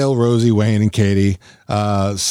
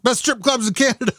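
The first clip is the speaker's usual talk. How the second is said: yelling; 13 semitones higher than usual. Retold or imitated. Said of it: "she yells back about".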